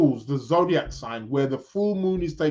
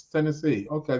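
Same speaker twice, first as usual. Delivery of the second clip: normal talking voice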